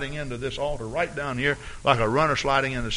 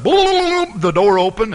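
high, loud burst; sudden boom; 7 semitones higher, 7 dB louder, slower than usual. Retold or imitated. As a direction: jolt the room with the bang. Retelling the door bursting.

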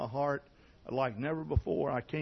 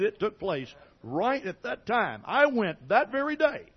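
preaching at full force; 8 semitones higher, 6 dB louder than usual. Acted. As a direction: bring it home like a revival preacher.

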